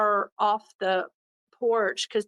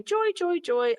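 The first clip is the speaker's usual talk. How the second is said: high sing-song call of a pet's name, stretched out; playful high call to an imagined puppy; 8 semitones higher than usual. Pretend. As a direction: call the dog's name in a happy lilting tune. Calling her dream dog's name.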